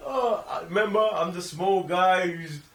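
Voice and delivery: normal talking voice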